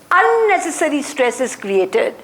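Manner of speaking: unnecessary leaned on with a rise; teacher stressing unnecessary for the class. Shown as a demonstration. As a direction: underline the word unnecessary as you say it. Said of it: "I underline the word unnecessary".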